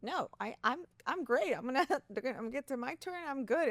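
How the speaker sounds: pretending to be totally fine; mock breezy confidence. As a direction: brush it off like all is fine. Retelling her false confidence.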